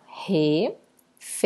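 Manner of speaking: "refeição" broken into re, fei, ção; split into syllables. Shown as a demonstration. Teacher separating the word, "refeição" syllable by syllable. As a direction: pause after each syllable of "refeição". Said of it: "eu separo".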